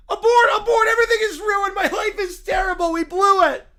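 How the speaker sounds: frantic yelling, half laughing; hammed-up panic as the prank call fails; panicked shouting. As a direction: shout that everything is ruined.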